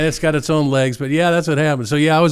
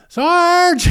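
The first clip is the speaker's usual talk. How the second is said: much higher, louder call for the sergeant; 6 dB louder than usual. Retold or imitated. MP calling his sergeant over, loud high call. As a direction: yell across the checkpoint for help.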